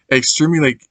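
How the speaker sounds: ordinary delivery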